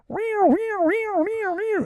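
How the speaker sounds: very high meowing cat voice. Retold or imitated. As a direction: mew it high like a kitten. Imitating the virus sound.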